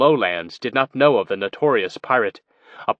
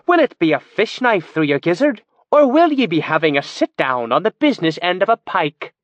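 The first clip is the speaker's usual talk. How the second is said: put-on bright, charming thick North Isle Moonshay accent; a jolly Moonshay pirate-barmaid offering a drink; 6 semitones higher, 3 dB louder than usual. A narrator's reading. Mimic playing Old Bess with a cheery Moonshay accent. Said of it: "cheerfully listing increasingly gory methods of death".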